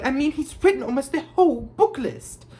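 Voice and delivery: high-pitched gushing; excited squeal. A narrator's reading. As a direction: gush in a high, thrilled girlish squeal. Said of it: "squealed Hermione".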